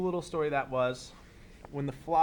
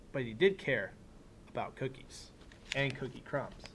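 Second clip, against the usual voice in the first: put-on reading to children; 2 semitones lower, 4 dB quieter than usual. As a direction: read it like story time for a class. Reads picture book aloud.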